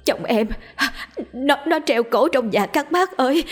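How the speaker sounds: high, frantic cry for help; panicked screaming. A narrator's reading. A wife crying out to neighbours for help, panicked. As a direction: scream for help as if a husband hanged himself. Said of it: "Bà ta vừa chạy vừa la hét".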